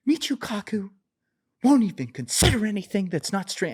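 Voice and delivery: high, pointed impression of the ranting mathematician; mock Weinstein rant. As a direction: accuse him with mock intensity. Imitating Weinstein again.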